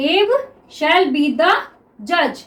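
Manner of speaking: very slow, syllables stretched; slow dictation. Shown as a demonstration. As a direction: read back slowly. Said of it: "ये क्या हो गया हमारा".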